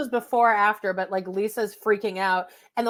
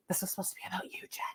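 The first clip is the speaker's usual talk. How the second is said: mock whisper; voiceless hushed aside; 2 semitones lower, 18 dB quieter, much faster than usual.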